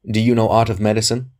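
spring the question suddenly; abrupt. A narrator's reading. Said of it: "he asked abruptly".

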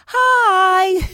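a sugary greeting, sung up high; sing-songy hi. Retold or imitated. Flight attendant greeting each passenger, sing-song Hi. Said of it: "sing-songy".

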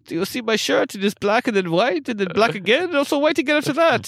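put-on high hammy French accent. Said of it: "That's not even a French accent anymore".

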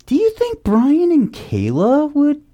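high, light mom voice; imitating mom. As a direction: raise the voice to play mom. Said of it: "she goes".

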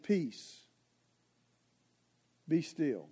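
"Peace, be still" said low and soft; calm the crying baby gently; soft hush. Acted.

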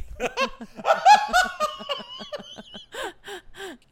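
long shrieking laugh; a long high cackle. Real, not acted. Roast joke lands.

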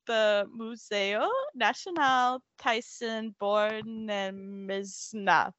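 "Museo Nacional Thyssen-Bornemisza" spoken slowly with careful Spanish vowels; Spanish pronunciation. Shown as a demonstration. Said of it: "You sound natural".

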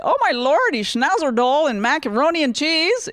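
high-pitched, drawn-out mock rapture; put-on high voice, theatrical delight; mock English exclamation. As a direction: exclaim it high and stretched, hamming it up.

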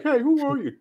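high, clueless greeting, mocked; dumb guy voice. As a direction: play the oblivious dumbass. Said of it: "he's like".

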